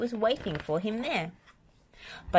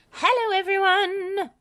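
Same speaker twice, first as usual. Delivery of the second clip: high, loud booming hello; roaring greeting; 6 dB louder than usual. A narrator's reading. Wendy in the dragon costume greets everyone, loud. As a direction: announce yourself grandly. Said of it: "roared a voice".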